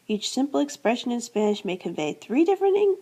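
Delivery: ordinary delivery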